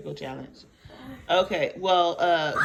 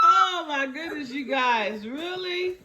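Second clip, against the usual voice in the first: giggling; 8 semitones higher, slower than usual. Real, not acted. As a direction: burst out laughing. Kids fail, mom laughing.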